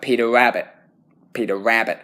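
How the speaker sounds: "Peter Rabbit" in a lazy American accent. Shown as a demonstration. Student showing the unclear R, American accent. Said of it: "I'm putting the accent to it".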